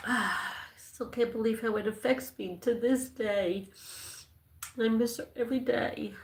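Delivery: soft, tearful and halting; barely holding back tears; real crying. Real, not acted. Missing his best friend on live, quietly crying. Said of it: "here I am crying".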